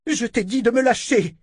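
high, strained order; high shouted command; furious command. Acted. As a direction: scream it as the flames rise. Sister in fire magic.

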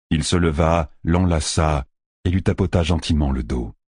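ordinary delivery

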